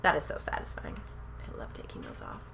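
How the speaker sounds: soft satisfied whisper. Real, not acted. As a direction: breathe it out, savouring the moment. Peeling the film off, whispered.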